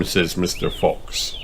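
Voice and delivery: ordinary delivery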